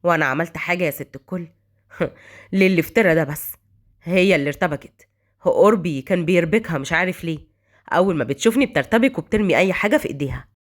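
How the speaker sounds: low, unbothered male character; cold. A narrator's reading. Son denies bothering the maid to his mother, cold. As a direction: play innocent in a deep, cool voice. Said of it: "رعد ببرود".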